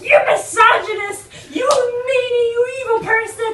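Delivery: high, loud, hammed-up tirade; outraged yelling. Lady berating a blind man on the bus, mock-outraged yelling. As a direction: imitate an indignant passenger shrieking accusations. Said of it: "started yelling at him".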